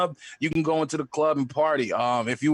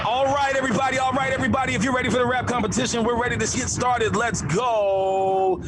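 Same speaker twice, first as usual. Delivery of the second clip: radio DJ hype; 7 semitones higher than usual. Retold or imitated. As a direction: pump up the club crowd like a radio DJ. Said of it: "he's, like".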